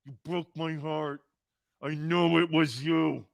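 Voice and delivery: hushed, wounded accusation in a mock Godfather voice; mock Godfather lament. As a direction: Doug Peterson telling Howie he betrayed him, mock Godfather hush. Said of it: "Doug Peterson going over to Howie".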